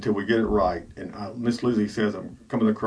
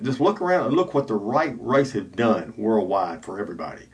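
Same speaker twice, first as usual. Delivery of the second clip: ordinary talk, nothing marked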